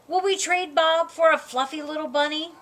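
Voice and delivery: ordinary delivery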